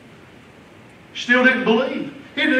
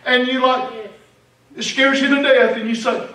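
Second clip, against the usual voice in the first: normal talking voice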